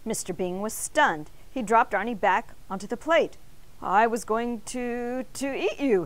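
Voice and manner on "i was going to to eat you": halting, shocked reply; startled and hesitant. A narrator's reading. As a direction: stumble over it, caught off guard. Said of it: "he replied in shock".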